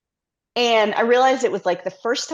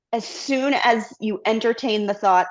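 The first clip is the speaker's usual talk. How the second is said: ordinary delivery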